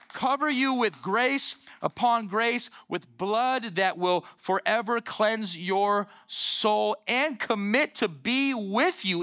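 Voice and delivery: rising, swelling sermon climax; building preaching. Acted.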